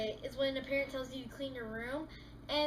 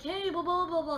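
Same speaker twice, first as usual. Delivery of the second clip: mock chatter; 3 semitones higher, 4 dB louder than usual. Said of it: "they're like".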